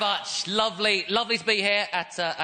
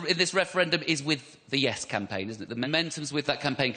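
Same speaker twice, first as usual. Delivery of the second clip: normal talking voice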